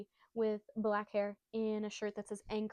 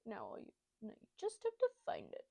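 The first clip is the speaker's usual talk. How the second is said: odd dying-pirate voice; 6 dB quieter than usual. Retold or imitated. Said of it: "I think he says it like that".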